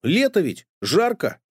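put-on high puzzled boy voice; curious boy Uncle Fyodor. A narrator's reading. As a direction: ask puzzled like a small boy. Boy continues.

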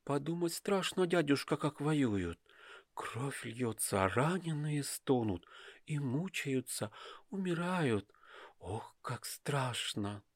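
put-on a girl trembling at thoughts of war; frightened young girl. Dasha's voice in the story. (испуганный тихий девичий голос)

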